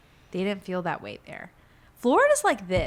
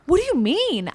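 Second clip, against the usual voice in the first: disbelief; 8 semitones higher, 8 dB louder than usual. Retold or imitated. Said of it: "I'm like".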